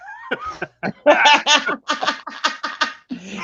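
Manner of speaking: high burst of laughter. Real, not acted.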